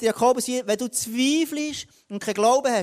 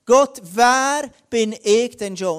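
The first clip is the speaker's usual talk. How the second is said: slow, loud appeal with WER drawn out; emphatic self-doubting protest; 3 semitones higher, 7 dB louder, much slower than usual. Acted. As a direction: plead the question slowly and forcefully, falling at the end. Repeats Gideon's protest to God.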